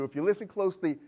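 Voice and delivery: normal talking voice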